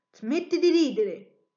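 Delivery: slow, loud, offended scolding. A narrator's reading. Geppetto scolding the laughing wooden mouth, loud and offended.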